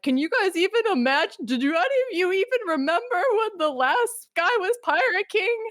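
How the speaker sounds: high incredulous mock voice; mocking Sengoku. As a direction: squeal it out like a scandalized official.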